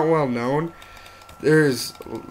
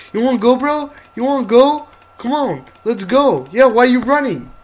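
taunting; 10 semitones higher, 7 dB louder than usual. Acted. Challenging a pig mob.

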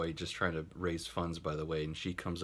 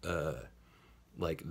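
usual speaking voice

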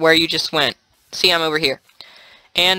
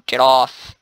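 ordinary talk

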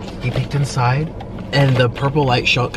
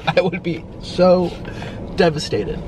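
emotional; 5 semitones higher than usual. Real, not acted. Worrying about losing her boyfriend, choked up. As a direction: let the feeling catch your voice. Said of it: "I don't know why I'm emotional".

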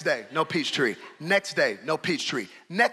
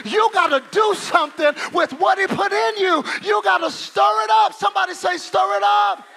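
loud, high preaching, nearly shouting; fiery preacher stirring the congregation; preaching at full force; 11 semitones higher, 6 dB louder than usual. Acted. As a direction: whip the church up with shouted calls.

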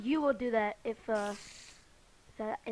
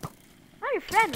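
high, slow pleading; 8 semitones higher, 7 dB louder, slower than usual. Real, not acted. Gamer pleading with a creature, high and slow.

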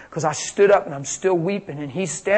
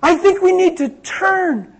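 put-on higher and louder, earnest young woman; young woman's voice; 13 semitones higher, 8 dB louder than usual. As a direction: speak as the girl delivering God's message. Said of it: "Spanish girl says".

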